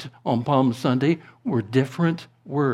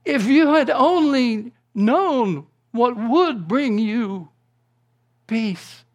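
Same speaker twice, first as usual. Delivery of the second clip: anguished shout; 9 semitones higher, 3 dB louder, slower than usual. Retold or imitated. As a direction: cry out in grief, then let it die away. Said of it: "shouted himself".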